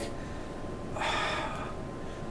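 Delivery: long, very soft exhale in imitation; acted heavy breath. Punisher looking at the guy, long acted exhale. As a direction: let out a drawn-out acted sigh.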